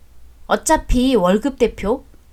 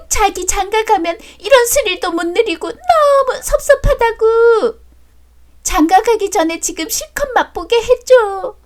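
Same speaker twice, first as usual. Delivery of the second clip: much higher, coy, simpering lover's coo; mocking mistress voice; 9 semitones higher than usual. Wife mimics rival's video flirting, high and cooing. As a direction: ham up the cheating woman's sweet talk. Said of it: "가정 있는 년 입에서 나오는 소리가 그랬잖아".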